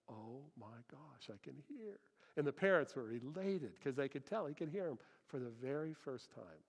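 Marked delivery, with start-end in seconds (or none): 0.1-2.0 very soft, slow wonder; barely audible, as if awestruck; hushed amazement. Retold or imitated. Preacher voices a toddler hearing for the first time, hushed amazement.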